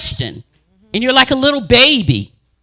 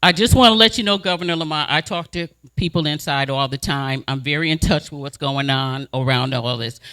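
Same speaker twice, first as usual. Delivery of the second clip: lower, soft and polite question; calm polite; 7 semitones lower, 5 dB quieter than usual. Retold or imitated. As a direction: keep it calm and courteous. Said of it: "I don't get nasty until you get nasty".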